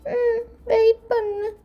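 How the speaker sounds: high, halting little voice; slow, teary child's reply; tearful small child. Acted. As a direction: answer in a small high voice, close to crying.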